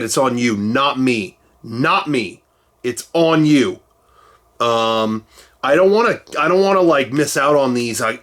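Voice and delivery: ordinary talk, nothing marked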